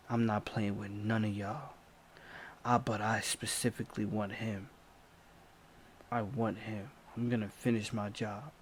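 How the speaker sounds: quietly obsessed threat; attacker warning the cousins softly. Retold or imitated. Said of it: "He like".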